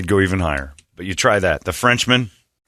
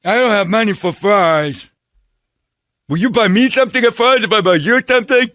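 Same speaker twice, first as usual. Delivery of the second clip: much higher, slowed put-on voice; mocking mentally challenged voice; 11 semitones higher, 3 dB louder than usual. Still in the mock mentally challenged voice, asking the beggar for money. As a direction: keep the high caricature voice going. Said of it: "he went full R word".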